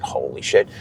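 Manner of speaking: breathless whispered awe; a star-struck hushed curse; awed whisper. Retold or imitated. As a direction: whisper it, stunned he's right there. Star right next to him.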